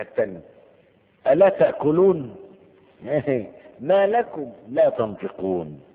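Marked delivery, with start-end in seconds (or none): 1.3-5.8 loud, slow, sneering question with a laugh; mock scornful address to idols; mocking taunt. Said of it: "تهكم واستهزاء".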